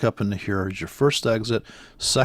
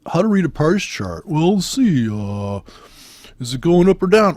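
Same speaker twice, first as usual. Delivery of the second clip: mocking course seller; 4 semitones higher, 4 dB louder than usual. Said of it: "where they go".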